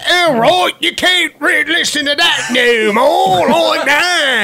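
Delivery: high, drawn-out mock British wife; hammed-up high British nagging; bad British accent. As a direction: shriek it as a scolding British wife. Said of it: "we did our shitty British accents".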